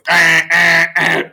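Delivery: loud, high staccato zapping noise; short loud bursts like a shorting wire; imitated electric zap. Game master voices the wrong wire going off, loud zapping. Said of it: "the whole thing just goes".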